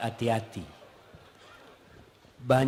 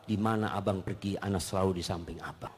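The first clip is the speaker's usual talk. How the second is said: softer, fast, sweet and devoted; tender to husband; 8 semitones lower, 10 dB quieter than usual. Acted. Wife tells her husband sweetly she follows him anywhere. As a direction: murmur it lovingly to your husband. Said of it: "katakan kepada suami".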